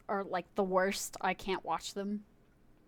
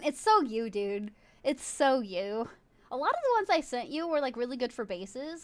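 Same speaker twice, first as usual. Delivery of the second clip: usual speaking voice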